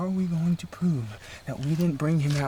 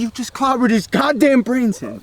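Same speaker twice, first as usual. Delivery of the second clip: loud, higher furious rant, faster at times; heated yelling; angry shouting; 9 semitones higher, 10 dB louder than usual. Acted. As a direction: lay into him hard. Heated argument continues.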